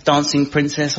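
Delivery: usual speaking voice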